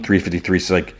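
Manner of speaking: ordinary delivery, nothing marked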